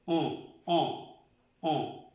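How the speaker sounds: On nasal, with a held-nose sound; On, a nasal o without an n. Shown as a demonstration. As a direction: say On through the nose. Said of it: "Es un sonido nasal".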